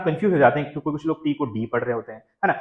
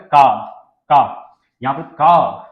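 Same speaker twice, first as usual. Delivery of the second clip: का drawn out and louder, long open "kaa"; emphasized का; 8 dB louder, much slower than usual. Shown as a demonstration. Teacher stresses the British का for car. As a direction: lean hard on का and stretch it. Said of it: "थोड़ा सा आप emphasise करोगे का".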